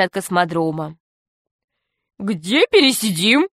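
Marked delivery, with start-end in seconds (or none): 2.2-3.5 comic pirate asking, swooping pitch; put-on cartoon villain voice; Весельчак У. A narrator's reading. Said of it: "спросил весельчак У". (комическим голосом пирата, вопросительно)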